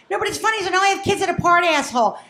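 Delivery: ordinary talk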